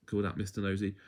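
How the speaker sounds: plain reading voice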